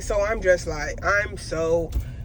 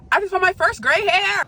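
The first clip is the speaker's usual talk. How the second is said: excited; 9 semitones higher than usual. Real, not acted. Vlogger repeats her gray hair find, excited and high. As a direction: squeal the news the second time.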